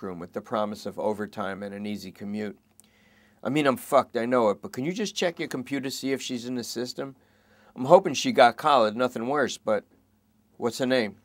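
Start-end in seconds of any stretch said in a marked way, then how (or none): 3.4-11.2 higher, louder plea; begging suspect. Acted. Suspect begging the cops to search for her, higher anxious. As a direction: beg them to check.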